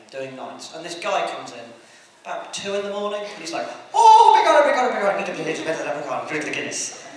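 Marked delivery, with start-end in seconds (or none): 3.9-7.1 hammed-up stage-Irish babble, starting high and loud, falling away; fake Irish accent. Wannabe Irishman at a night fast-food counter, fake Irish accent. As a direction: gabble like a bad fake Irishman. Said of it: "someone who desperately wished he was Irish".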